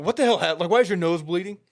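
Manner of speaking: imitated double-take outburst; mock alarmed yell at a bleeding player; shocked coach. Coach spots bloody nose.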